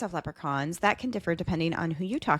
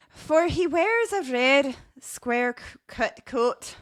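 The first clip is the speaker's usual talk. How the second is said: slow, higher voice slipping into Scottish; botched Irish accent; 5 semitones higher, slower than usual. Acted. Host reading a leprechaun's dress, Irish accent turned Scottish. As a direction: attempt Irish and land in Scottish, slowly. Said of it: "Nope, that's, that's Scottish".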